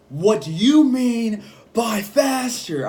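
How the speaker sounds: high, loud, drawn-out indignant question; mad outburst. Retold or imitated. Coworker taking offense at a suggestion, mad outburst. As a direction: imitate an offended coworker flaring up. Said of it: "he starts getting mad".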